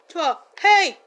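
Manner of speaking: normal talking voice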